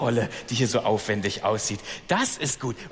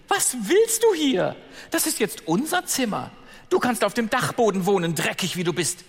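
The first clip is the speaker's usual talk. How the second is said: high, loud, sneering stepsister; spiteful stepsister; 10 semitones higher, 3 dB louder than usual. Acted. Stepsister chasing Ella out of her room, high and sneering. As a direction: look down your nose and jeer, up high. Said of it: "Eine rief".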